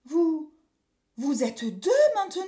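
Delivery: high, loud disbelief; startled boy. Acted. Boy discovers there are two witches, startled. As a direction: blurt it out as an astonished child. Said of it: "s'écria Pierre en sursautant".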